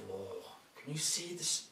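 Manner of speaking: lively storybook reading; reading to children. A narrator's reading. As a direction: read it big for little ones.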